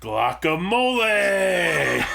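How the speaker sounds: loud, slow, laughing shout; punchline shout. Pretend. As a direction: yell the punchline with glee. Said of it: "the delivery sold that one".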